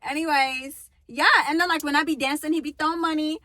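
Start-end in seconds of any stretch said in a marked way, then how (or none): none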